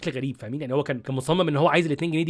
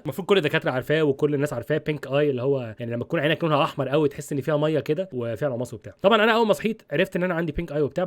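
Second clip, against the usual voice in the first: normal talking voice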